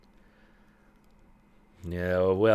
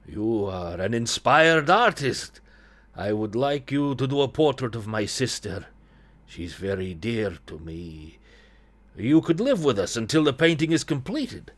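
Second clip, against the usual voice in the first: put-on Ilya, Eastern European accent. Brother commissions his sister's portrait, Eastern European accent. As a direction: lay on a vague Eastern European accent, measured. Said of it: "I don't know what accent I'm doing here".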